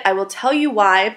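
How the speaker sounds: ordinary talk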